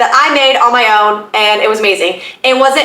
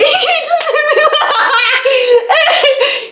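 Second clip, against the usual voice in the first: delighted laugh. Real, not acted. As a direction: laugh high and long, delighted. Laughs as he walks back in.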